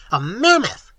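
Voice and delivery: triumphant bawl. A narrator's reading. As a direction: crow it out. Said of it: "bawled Professor Small triumphantly".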